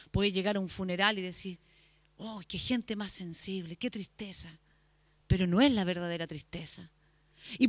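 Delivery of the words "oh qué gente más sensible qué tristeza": mock hushed sorrow. Imagined mourner at funeral.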